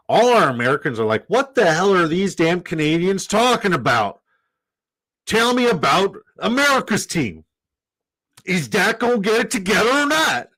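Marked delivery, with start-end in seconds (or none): 1.3-10.5 hammed-up Texan American asking about America's team; Texan accent. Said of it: "that's a goddamn good Texan accent".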